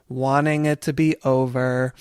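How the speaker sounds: usual speaking voice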